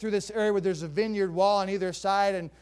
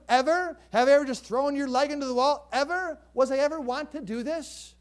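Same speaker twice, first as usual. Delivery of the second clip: higher, louder exasperated donkey; indignant donkey; 6 semitones higher, 6 dB louder than usual. Acted. Donkey scolding its owner, higher exasperated pleading. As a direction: press each ever like a fed-up pet. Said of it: "The donkey says to the owner".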